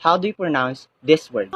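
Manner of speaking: teacher. Acted. Teacher opens the pronunciation quiz for the class, low formal voice. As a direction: address the class in a low, measured voice.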